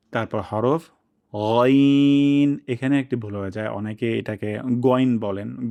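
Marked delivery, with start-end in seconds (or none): 1.4-2.6 loud, long গইন from the top of the throat; heavy ghain. Shown as a demonstration. Teacher introducing the letter, long heavy গইন. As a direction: hold গইন with a thick throaty gh. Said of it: "মোটা সাউন্ডে উচ্চারণ করব".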